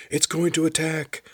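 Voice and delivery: high-pitched shouted warning. A narrator's reading. Soldier warns the others of an attack, shouting. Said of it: "a man shouted".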